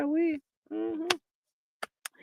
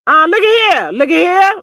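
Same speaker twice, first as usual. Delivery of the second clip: loud, higher call; 7 semitones higher, 7 dB louder than usual. Real, not acted. Vlogger calling out to the chat to look. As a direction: grab the chat's attention.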